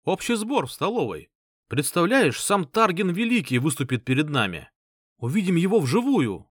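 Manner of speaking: high eager rush of news; thrilled, can't wait; excited. Acted. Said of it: "Восторженный Варг".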